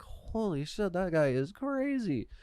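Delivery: soft, high, drawn-out disbelief; hushed amazement. Retold or imitated. Storyteller replaying watching the screamer walk off, hushed amazement. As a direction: marvel quietly, stretching the words.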